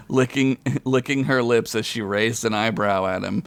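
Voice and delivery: usual speaking voice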